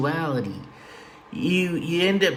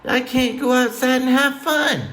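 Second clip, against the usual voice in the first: higher, whiny complaining voice; whiny relative; 10 semitones higher than usual. Retold or imitated. Family member complaining about the weather, higher whine. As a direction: whine like a sulky kid. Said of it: "They're like".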